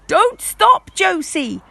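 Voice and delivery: high, loud cheering shout; shouted encouragement. Acted. Parents cheer Josie from the crowd, loud high shout. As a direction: yell her on from the crowd. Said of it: "shouted Grandma Jump".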